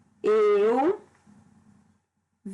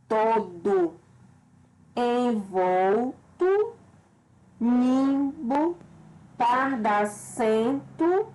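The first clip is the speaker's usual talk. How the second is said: each word drawn out slowly with the penultimate syllable leaning heavier; stressed syllable shown; much slower than usual. Shown as a demonstration. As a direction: stretch every word and press its stressed syllable. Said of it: "apresentam a sílaba tônica na penúltima". (palavras alongadas com ênfase na sílaba tônica)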